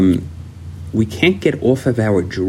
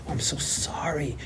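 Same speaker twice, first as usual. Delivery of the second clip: very soft, higher, cowed voice; meek apology; 4 semitones higher, 14 dB quieter than usual. Retold or imitated. Apologizing to the girl who screamed, meek. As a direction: shrink into a hushed apology. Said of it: "she screams at you".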